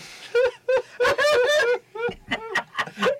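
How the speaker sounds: squeaky high laugh after a joke; high-pitched laugh. Retold or imitated. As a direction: let out a thin, squeaky giggle. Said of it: "he does a high-pitched laugh after he does a joke".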